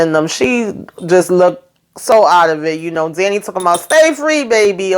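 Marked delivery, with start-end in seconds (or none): 3.8-5.0 high, hammed-up goodbye; mock send-off. Danny sending Sabrina off, hammed-up high. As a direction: sing it out high and cheeky.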